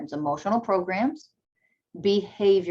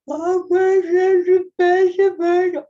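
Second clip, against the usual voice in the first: high and much louder, hammed-up alarm; mock panicked parent; 12 semitones higher, 10 dB louder than usual. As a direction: overreact like a flustered parent. Parent panicking at a crying child.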